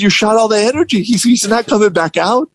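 high, quick mock reproach; lightly voiced upset manager. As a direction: mimic a manager laying blame. Manager blames him, retold.

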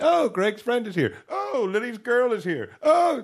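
high, excited voice of his wife naming guests; chirpy know-it-all guessing. Retold or imitated. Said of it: "very good at just knowing who it was by the accent".

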